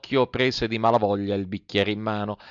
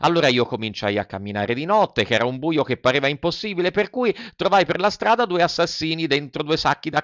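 lightly voiced puppet boy storyteller; chattering Pinocchio; 6 semitones higher than usual. Puppet tells the fairy about the assassins, chattering. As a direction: recount it in a slightly raised childish voice.

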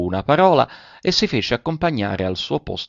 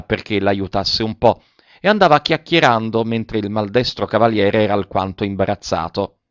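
lively, higher-pitched society lady; animated, sociable countess prattle; chatty countess; 3 semitones higher, 3 dB louder than usual. Acted. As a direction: chatter on brightly, hopping between topics.

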